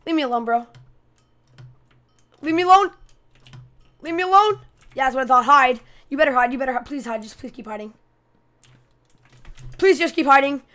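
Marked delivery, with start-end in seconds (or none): none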